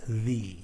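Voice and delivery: slow "the" with a long E; THE as thee. Shown as a demonstration. Teacher stating the vowel rule, "the" as thee. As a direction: say "the" to rhyme with see. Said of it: "It sounds like E".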